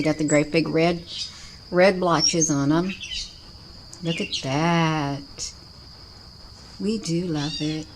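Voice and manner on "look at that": drawn out slowly, savoring it; slow, lingering look at that; slow marvelling. Real, not acted. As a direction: let "Look at that" stretch out slowly in wonder. Repeated viewing remark, slowed.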